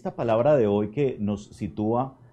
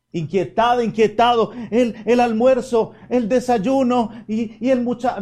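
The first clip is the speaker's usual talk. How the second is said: higher, louder hurried fret about chores; mock anxious rush, pitched up and loud; flustered fretting; 10 semitones higher, 5 dB louder than usual. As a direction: rattle off worries like a frazzled priest. Mocking his own fretful rush.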